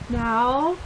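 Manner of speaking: ordinary delivery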